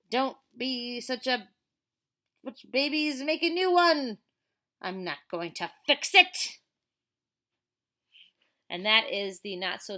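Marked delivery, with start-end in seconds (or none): 0.1-6.6 put-on loud sneering kid voice; mean kid sneer, loud; mean bully. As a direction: sneer it down at the little one. Bully in the comic.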